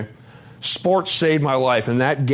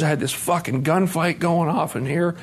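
usual speaking voice

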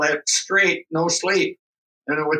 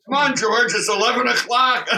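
raised, coaxing wake-up call, lightly done; mock wake-up call; 7 semitones higher than usual. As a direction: nudge a sleepy man awake. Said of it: "getting Sleeping Beauty here up in the morning is".

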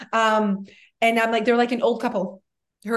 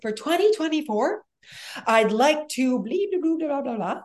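mock goal-setting; 4 semitones higher than usual. Mimicking people stating new year goals.

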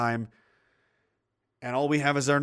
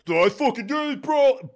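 mock bro brag; 8 semitones higher than usual. Mocking reservist bragging.

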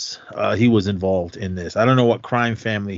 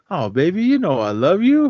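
mock pleading lover; 10 semitones higher, 4 dB louder than usual. Acted movie scene, pleading lover.

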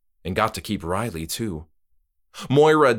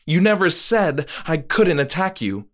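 higher, louder; arguing; 7 semitones higher, 3 dB louder than usual. Acted. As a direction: argue your case. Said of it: "she argued".

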